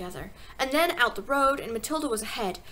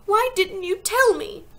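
high, loud, drawn-out reproach; pitched high and pleading; shocked cry; 9 semitones higher, 8 dB louder, slower than usual. A narrator's reading. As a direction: wail it as a hurt child. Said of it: "Matilda cried".

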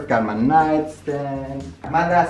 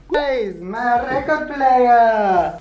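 high, slow delighted squeal; excited cute voice; 12 semitones higher, 3 dB louder, slower than usual. Pretend. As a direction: gush over it in a high voice. Excited voicing an object.

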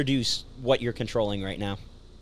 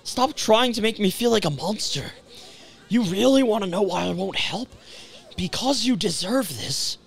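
begging, then turning cruel; plead, then go cold; 10 semitones higher, slower than usual. Acted. Voices game dialogue.

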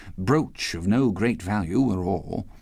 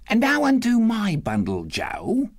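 brazen woman; 13 semitones higher than usual. A narrator's reading. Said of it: "said the first woman".

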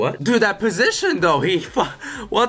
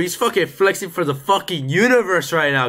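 ordinary talk

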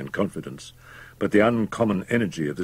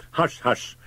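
higher, anxious shushing; exclaimed hushing; 6 semitones higher than usual. A narrator's reading. Doctor silencing Fettes, anxious hushing. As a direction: shush him quickly without raising volume. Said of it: "exclaimed the doctor".

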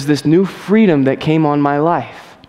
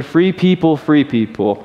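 call and response; slower than usual. Shown as a demonstration. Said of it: "Can we say that again?".

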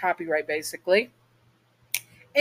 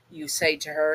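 usual speaking voice

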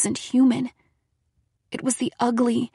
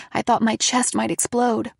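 usual speaking voice

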